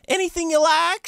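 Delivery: very high put-on catchphrase voice; Cindy. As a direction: pipe it up high like a game character. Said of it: "She says that over and over".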